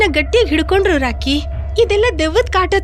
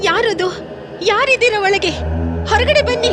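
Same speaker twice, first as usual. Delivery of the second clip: calling out; 4 semitones higher than usual. Acted. Calling into a dark house.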